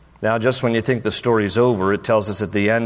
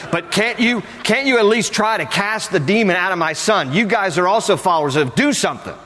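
higher, pressing plea from a frustrated dad; exasperated pleading; 7 semitones higher than usual. Acted. Dad begging the disciples to try, exasperated pleading. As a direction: mock-desperate appeal, pitched up.

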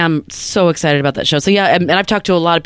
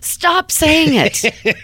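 much higher, very drawn-out squeal of protest; exasperated plea; 12 semitones higher, much slower than usual. Real, not acted. Co-host begging host to drop the topic, high and drawn out. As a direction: beg him to stop, stretching it out high. Co-host begs him to stop.

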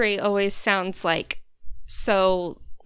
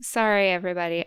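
ordinary talk

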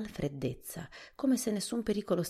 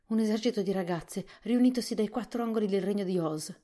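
frightened; 6 semitones higher, 6 dB louder than usual. A narrator's reading. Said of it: "ancora pallido per la paura".

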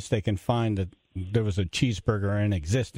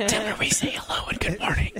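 whisper yelling; 10 semitones higher, faster than usual. Pretend. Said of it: "don't say it in a nice tone".

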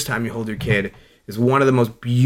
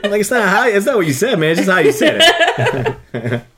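higher, laughing half-protest; 9 semitones higher, 3 dB louder than usual. Retold or imitated. Defending himself to a teasing coworker, laughing protest. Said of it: "And I'm like".

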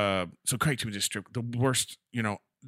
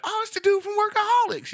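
high excited fan voice, pitched way up; recognizing a celebrity. Retold or imitated. Strip club crowd spotting the TV star, excited squeal.

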